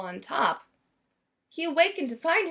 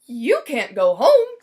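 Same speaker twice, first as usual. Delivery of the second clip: louder, slow showman's declaration; Signor Valentini; 11 dB louder, slower than usual. Acted. Valentini telling Lyle after the escape, loud and slow. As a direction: announce it like a stage star.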